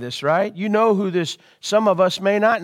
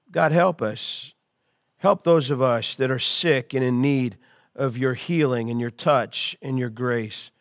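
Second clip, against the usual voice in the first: much lower, softer prayer; 8 semitones lower, 5 dB quieter than usual. Acted. Pastor praying for those in need of healing, soft low prayer. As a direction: pray gently for the sick. Prays for the sick.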